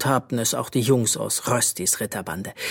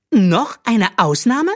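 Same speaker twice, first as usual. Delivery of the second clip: much higher, louder, slow scornful question; mocking; 10 semitones higher, 7 dB louder, slower than usual. A narrator's reading. Princess mocking Rösti's suggestion, scornful. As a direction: sneer at the idea. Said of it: "entgegnete Magnesia spöttisch".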